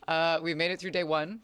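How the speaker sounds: ordinary delivery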